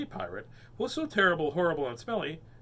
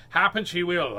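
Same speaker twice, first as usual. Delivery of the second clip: pirate agreeing; 3 semitones higher, 7 dB louder than usual. Acted. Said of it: "agreed the horrible".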